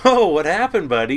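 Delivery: high, loud mock concern; playful teasing. Crew ribbing a kid just pulled from the water, playful teasing. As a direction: tease him with exaggerated sympathy. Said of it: "kind of making fun of him".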